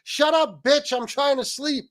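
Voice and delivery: high-pitched mock wife voice; badly imitated wife, high and complaining; acted irritated wife. Wife trying to sleep scolds ranting husband, mock irritation. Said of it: "I can't do her accent".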